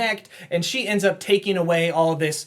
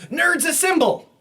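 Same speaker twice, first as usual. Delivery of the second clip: rallying cry; 11 semitones higher than usual. Acted. Johnny rallies MIT students.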